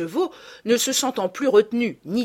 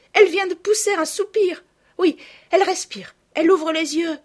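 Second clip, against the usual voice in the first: higher and louder, breathless relief; joyful exclaiming; 10 semitones higher, 8 dB louder than usual. A narrator's reading. Camille reports to the nurse the child is waking, joyful. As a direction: announce every sign of life with joy. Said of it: "un cri de joie".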